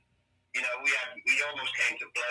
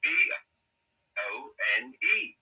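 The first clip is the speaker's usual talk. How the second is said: letters spelled out slowly; slow, deliberate spelling out; slower than usual. Shown as a demonstration. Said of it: "how do you spell bone".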